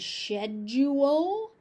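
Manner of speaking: "schedule" as SHED-yoo-ul, British, slow and rising; British pronunciation. Shown as a demonstration. Teacher contrasting British with American, British shed-yoo-ul. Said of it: "the British pronunciation of".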